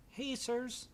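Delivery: put-on high-pitched little boy voice; high, boyish call to grown-ups; young boy. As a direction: pipe up as a small kid. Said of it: "a young boy with kind of".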